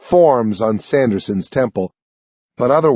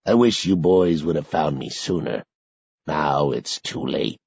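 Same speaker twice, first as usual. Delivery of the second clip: resigned sorrow; sad; 8 semitones lower, 5 dB quieter than usual. A narrator's reading. Old man admitting his choice, low sad voice. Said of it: "Sanderson replies sadly".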